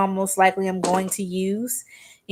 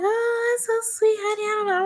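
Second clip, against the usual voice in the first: much higher, sugary clerk voice; sweet saleswoman; 14 semitones higher than usual. Retold or imitated. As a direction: coo it like a touched shop lady. Saleswoman touched by compliment.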